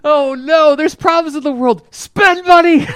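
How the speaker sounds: high-pitched, loud comic cry of alarm; mock panic. Co-host mocks their answer to everything, hammed-up panicked yell. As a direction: squeal in pretend panic, building to a shout.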